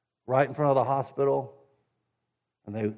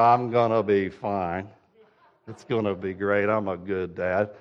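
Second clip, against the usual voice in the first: lightly voiced John Wayne swagger; 6 semitones lower than usual. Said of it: "do the John Wayne swagger".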